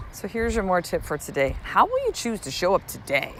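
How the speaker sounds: ordinary talk, nothing marked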